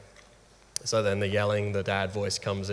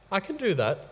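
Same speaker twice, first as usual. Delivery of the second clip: higher-pitched imitation of a willing child; pitched-up little-girl reply; daughter's voice; 10 semitones higher than usual. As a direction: lightly voice a daughter agreeing. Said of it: "she said".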